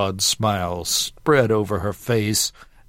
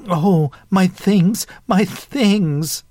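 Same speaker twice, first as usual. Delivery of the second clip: high, loud fluster; rattled; 9 semitones higher, 4 dB louder, slower than usual. A narrator's reading. Young woman whose box has crashed to the floor, rattled. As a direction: cry out in rattled dismay. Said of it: "obviously rattled".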